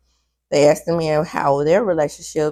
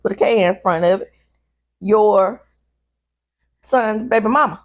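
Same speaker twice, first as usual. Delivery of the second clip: usual speaking voice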